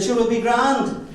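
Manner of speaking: breezy reassurance. Retold or imitated. Young driver brushing off worry.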